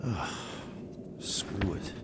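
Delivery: low, soft muttering; resigned mutter. Acted. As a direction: give up under your breath. Gives up, alone.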